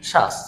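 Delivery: শ্বাস in one syllable, ব silent; correct pronunciation. Shown as a demonstration. Teacher showing the phonetic spelling, correct pronunciation. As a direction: say শ্বাস as shash. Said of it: "শুদ্ধ উচ্চারণটা আমরা যেটা দেখব".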